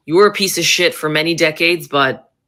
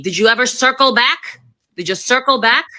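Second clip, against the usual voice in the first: higher, jeering taunt; mocking taunt; 7 semitones higher than usual. Real, not acted. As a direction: rub her own phrase in. Taunting Jen Psaki's catchphrase.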